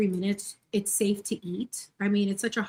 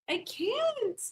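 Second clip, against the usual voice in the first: overcome; 11 semitones higher, 4 dB quieter than usual. Real, not acted. Overwhelmed by cuteness.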